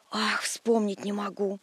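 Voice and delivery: plain reading voice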